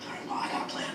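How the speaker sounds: conspiratorial whispered plan. Acted. Telling Frank his plan in a secret whisper. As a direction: lean in and whisper it. Scheming in the hallway.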